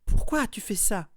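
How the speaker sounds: high, loud plea on the edge of tears; thin trembling voice. A narrator's reading. Neville, near tears, asks Harry why, high and loud. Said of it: "d'une voix fluette et tremblante".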